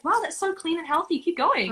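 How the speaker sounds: high impressed mother voice; mum impressed. Retold or imitated. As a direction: gush like a mum who doesn't get it. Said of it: "And she was like".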